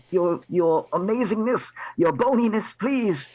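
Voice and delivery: high, pleading put-on voice; mimicked elf servant. Disguised elf begs the devils for halflings, high servile plea. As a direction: grovel to the masters in a high, hesitant voice. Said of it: "yell out in, like, kind of like".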